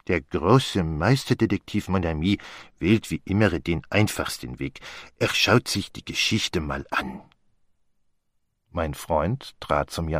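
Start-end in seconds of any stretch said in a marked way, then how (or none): 0.1-7.2 put-on French-accented, self-assured detective voice; Poirot. Teasing his friend at the window, as a self-assured Poirot. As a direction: speak with a measured French accent.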